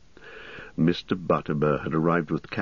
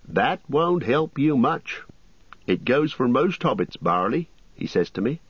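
higher, bustling landlord voice; fussy innkeeper; 8 semitones higher than usual. Acted. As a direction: play the chatty innkeeper, pitched up.

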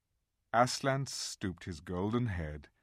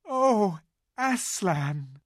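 much higher, louder cry of joy and fright; glad cry; 10 semitones higher, 7 dB louder, much slower than usual. Acted. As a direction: cry out his name in wonder. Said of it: "cried both the children".

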